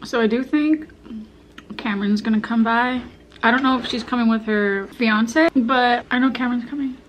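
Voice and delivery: chewing while talking; talking between bites; mouth full. Real, not acted. Seen 2: cheeks puffed, chewing noodles taken at -1.0 while talking.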